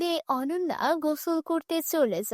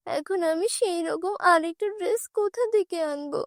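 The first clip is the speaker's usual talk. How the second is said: put-on distressed girl; 7 semitones higher than usual. Kabita distressed.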